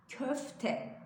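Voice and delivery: careful "köfte", köf-te; correct pronunciation. Shown as a demonstration. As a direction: spell out the ö sound in "köfte". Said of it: "küfte değil".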